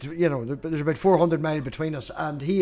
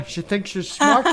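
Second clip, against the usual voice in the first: mock indignant; 4 semitones higher, slower than usual. Mock indignant reply to teasing.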